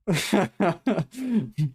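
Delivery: quick high laugh of disbelief; a short amused laugh. Real, not acted. Laughs at own point.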